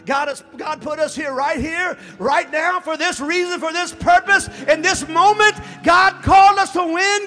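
loud, high climactic sermon shout; rising pulpit crescendo; preaching at full force. Acted. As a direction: drive the call home to the church.

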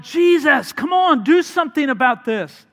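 high, loud, desperate cry for help; terrified disciples shouting at Jesus in the storm; panicked plea. Retold or imitated. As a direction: yell at him to wake up and act. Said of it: "they're like".

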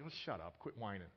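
low, soft mock brush-off; lightly voiced wife waving off a complaint; dismissive wife. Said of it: "she's like".

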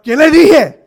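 high, loud, laughing scold; half-laughing scold; mock scolding mother in Spanish. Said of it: "in Spanish".

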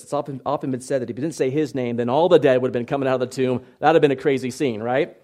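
ordinary talk, nothing marked

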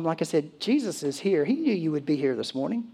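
ordinary talk, nothing marked

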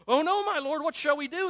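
high, loud cry of alarm; alarmed servant. Acted. Servant sees the army surrounding the city, crying out alarmed. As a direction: cry out in acted panic.

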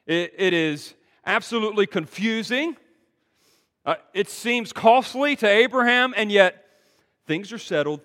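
loud, fast, high-pitched preacher's call; full-force preaching. Acted. Preacher pressing the congregation with the big question, loud and fast. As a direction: drive the question home to the congregation.

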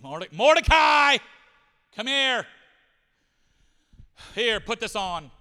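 loud, slow bark calling Mordecai over; shouting a summons. Acted. Haman shouting to Mordecai to come get the robe, loud and slow. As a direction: yell for him across the courtyard.

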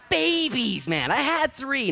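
high, loud burst about babies; almost shouting the word Babies with delight; excited exclaim. Real, not acted.

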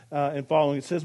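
ordinary talk

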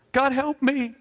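high, pleading cry for help; mock panic plea. Preacher mimics a desperate believer, mock panicked plea. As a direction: cry out to God in a panic, half mocking. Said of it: "pull the fire alarm when everything goes bad".

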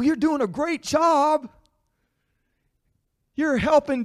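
high, loud encouraging parent; cheering someone on. Retold or imitated. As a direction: cheer a child on warmly. What was your attitude toward them.

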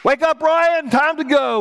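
high, loud rousing call; urgent wake-up call. Acted. Dad waking Brian to leave Egypt, calling out. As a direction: call out sharply to wake a sleeper.

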